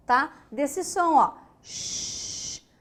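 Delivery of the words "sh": one soft voiceless Sh; a single hushed sh; sh sound. Shown as a demonstration. Teacher showing the phonetic symbol's sound, a soft sh. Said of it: "representação fonética".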